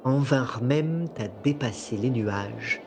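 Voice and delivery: calm, measured storytelling voice; game master narration. A narrator's reading. Game master sets the scene.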